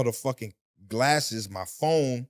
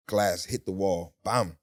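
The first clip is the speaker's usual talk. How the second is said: normal talking voice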